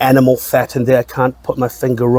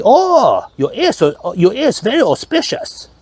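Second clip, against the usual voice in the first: high-pitched, hammed-up Chinese accent; badly faked Chinese accent, pitch leaping up; mock Chinese accent; 11 semitones higher than usual. Buddhist telling him about his lucky ears, hammed-up Chinese accent. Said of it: "is that even a Chinese accent?".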